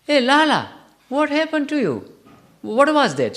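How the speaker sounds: high, loud startled cry; Mother Yashoda asking her son what happened. Retold or imitated. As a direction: wake up stunned and call to your little boy. Mother waking shocked, calling son.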